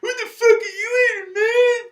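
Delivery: high, loud, sneering mock voice of a taunting meat eater. Mimicking meat eaters who taunt him over lunch, high jeering yell. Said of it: "they just come up and they go".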